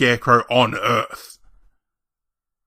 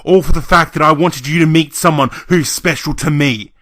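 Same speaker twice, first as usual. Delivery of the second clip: raised, emphatic indignant rant; angry outburst; 12 semitones lower, 7 dB louder than usual. Acted. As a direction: let pent-up grievances burst out. Acted furious rant at relatives.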